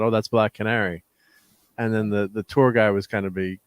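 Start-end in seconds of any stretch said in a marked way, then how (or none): none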